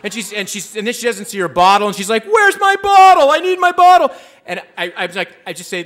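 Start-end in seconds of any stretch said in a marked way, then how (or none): 2.2-4.1 frantic screaming. Retold or imitated. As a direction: imitate a woman shrieking in desperation. Voicing her frantic search for bottle.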